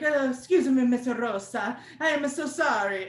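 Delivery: put-on apologetic phone agent putting an accent on "Rosa". Phone agent apologizing to angry customer, put-on accent on "Rosa". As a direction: lightly exaggerate the foreign-sounding "Rosa". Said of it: "You don't have to do the accent".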